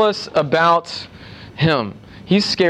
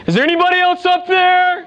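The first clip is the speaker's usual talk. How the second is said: desperate shout; 12 semitones higher than usual. Retold or imitated. Man on a branch calls past God for other help. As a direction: shout it up the cliff, hoping for someone else.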